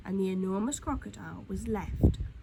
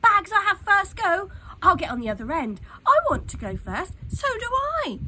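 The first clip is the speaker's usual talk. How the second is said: put-on loud, eager children clamouring; children fight over the new seesaw, shouting; excited shouting; 9 semitones higher, 11 dB louder than usual. A narrator's reading. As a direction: jostle to be first, shouting over each other. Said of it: "shouting with excitement".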